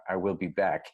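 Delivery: lightly done Austrian action-hero delivery; a hint of the famous cyborg, playful; Arnold Terminator line. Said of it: "he's for his Terminator speech".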